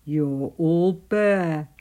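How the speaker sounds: slow, loud gruff animal bark; loud, drawn-out storybook bark; barking Badger. A narrator's reading. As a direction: boom it out slowly, like a blunt badger. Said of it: "barked Badger".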